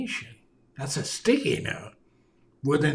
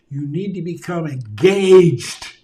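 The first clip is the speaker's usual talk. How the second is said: much louder, driving the point home; emphatic call; 9 dB louder than usual. Shown as a demonstration. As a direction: punch it out loud to wake them up. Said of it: "when I get to the point where I say".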